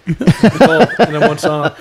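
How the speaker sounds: laughing through slow words; amused, drawn-out laugh; giggling as he explains. Real, not acted. Laughing about the parody.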